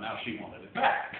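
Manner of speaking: ordinary delivery, nothing marked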